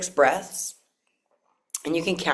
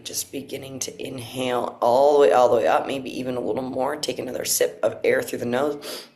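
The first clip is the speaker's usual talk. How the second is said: guided breathwork. Acted. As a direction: coach each breath calmly.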